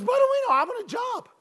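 put-on very high squeaky kid calling out; high squeaky Tommy. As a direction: pipe up with W for R. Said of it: "he had a high squeaky voice".

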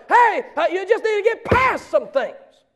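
loud, high mock preacher's exhortation; imitated preaching. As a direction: belt it out like a preacher. Quotes preacher preaching at him.

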